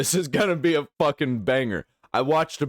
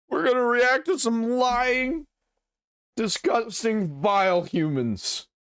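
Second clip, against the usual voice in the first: much higher, slow hammed-up disgust; mock dramatic outrage; 12 semitones higher, slower than usual. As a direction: sneer it like a cartoon villain announcing its prey. Hammed-up intro to next video.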